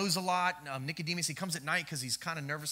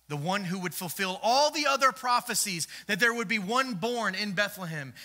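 rising, louder preacher's build; preaching build; 3 semitones higher, 4 dB louder than usual. Real, not acted. Pastor building to his congregation, louder. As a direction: build it like a sermon climax.

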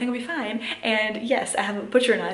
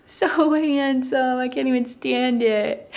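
slow, stretched-out cooing; adoring gushing; 3 semitones higher, 4 dB louder, slower than usual. Real, not acted. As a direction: gush over a little one, drawing words out. Gushing over dressed-up toddler.

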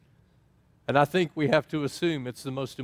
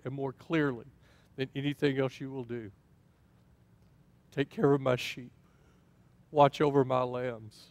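tender charge. Retold or imitated. Jesus pulling Peter close for final words, tender. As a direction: hand it over gently as a last wish.

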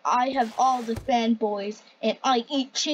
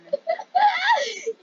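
high shrieking cackle; gleeful cackle. Acted. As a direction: cackle with glee.

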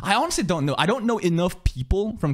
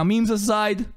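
normal talking voice

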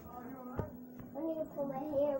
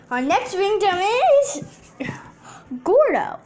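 dramatic announcement; 3 semitones higher, 13 dB louder than usual. Pretend.

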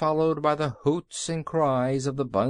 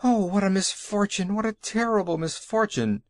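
wailing; 7 semitones higher than usual. A narrator's reading. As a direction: wail over the disaster. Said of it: "wailed Mister Cinnamon Bun".

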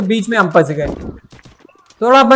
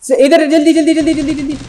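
urgent shouting; 6 semitones higher than usual. Real, not acted. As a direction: yell for help reviving a teammate. Teammate down, calling for revive.

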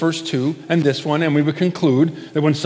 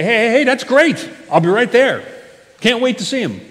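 higher and louder, hammed-up delight; mock cheerful welcome; 6 semitones higher, 3 dB louder than usual. Older brother pretending to welcome his brother, mock cheerful. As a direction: fake enthusiasm, as if you'd never be jealous. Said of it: "Would you say".

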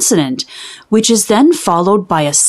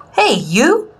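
calling out; 4 semitones higher than usual. Acted. Youthful mules calling Sugar Cube.

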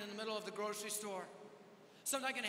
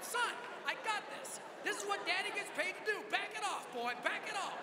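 high, loud fatherly brush-off; mock-stern dad; 8 semitones higher, 3 dB louder than usual. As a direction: shoo an interrupting child away, voice raised. Dad shoos interrupting son away.

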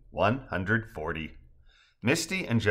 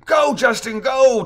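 high, loud yell to someone leaving; shouted send-off; screaming cheer; 14 semitones higher, 8 dB louder, slower than usual. A narrator's reading. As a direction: cheer them on at full voice. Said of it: "pointing to the zip line and screaming".

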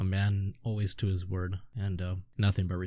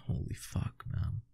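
whisper; 8 dB quieter than usual. Real, not acted. Awed reaction while reading.

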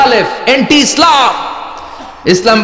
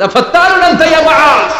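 sharp, much higher scolding shout; angry rebuke; 10 semitones higher, 3 dB louder than usual. Acted. Prophet rebuking Muadh for long prayers, loud angry scolding. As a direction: rebuke him hard, voice leaping up high. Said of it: "ধমক দিয়ে বললেন".

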